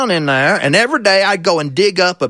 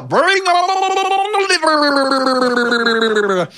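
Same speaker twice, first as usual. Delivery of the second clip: drawn-out, very slow call, pitched higher; catfish yelling; 8 semitones higher, much slower than usual. Acted. Catfish calls to farmer from the trough, slow drawn-out call. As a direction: stretch the call out long and slow. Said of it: "and he'd yell".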